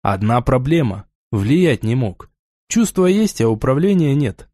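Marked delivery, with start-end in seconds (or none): none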